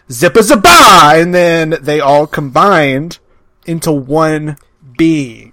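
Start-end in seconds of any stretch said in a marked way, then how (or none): 0.1-1.2 high, loud magic incantation; spell-casting wizard. Acted. Joker acting out Harry Potter casting a spell, loud incantation. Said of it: "Harry Potter came by and went".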